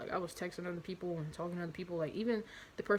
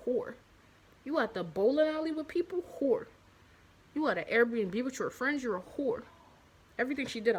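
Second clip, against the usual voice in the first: mock accusing rant; 4 semitones higher, 4 dB louder than usual. Mocking his past self accusing ex.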